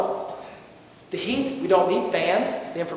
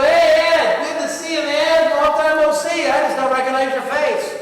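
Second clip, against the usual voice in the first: hearty greeting; 4 semitones higher, 7 dB louder, slower than usual. Retold or imitated. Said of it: "And you go".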